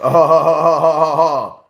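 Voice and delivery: big burst of laughter, loud; loud real laughter. Real, not acted. Host laughing at his own locker-room joke, loud cackle.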